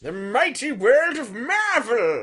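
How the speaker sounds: slow, loud, high trailer voice; mock announcer. Hammed-up announcer hyping the mighty world of Marvel. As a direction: boom it like a comic book ad.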